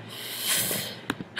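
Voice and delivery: long winded exhale; heavy breathy sigh. Real, not acted. Tutorial maker runs out of air, a worn sigh. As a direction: let out a tired sigh. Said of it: "I'm out of breath".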